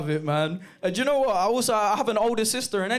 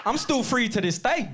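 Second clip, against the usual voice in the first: mock mum talking down; 3 semitones higher than usual. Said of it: "talks to police officers like they're fucking horses".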